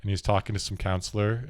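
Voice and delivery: ordinary talk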